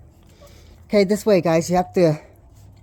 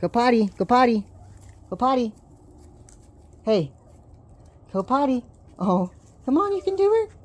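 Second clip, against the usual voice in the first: coaxing pet talk; 12 semitones higher, 4 dB louder than usual. Real, not acted. Owner urging puppies to potty, high sing-song baby talk. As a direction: cheer the puppy on to go.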